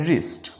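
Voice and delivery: wrist said with a silent W, starting on the R. Shown as a demonstration. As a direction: begin wrist on the R, no W. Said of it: "हमने इसको आर से start किया".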